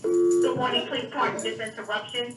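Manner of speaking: normal talking voice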